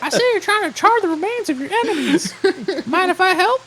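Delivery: very high, chipper helper voice; wholesome 1950s kid. Acted. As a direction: chirp it like an eager assistant. Clippy parody voice.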